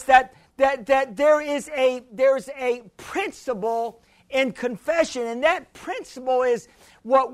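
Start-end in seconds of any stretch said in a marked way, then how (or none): none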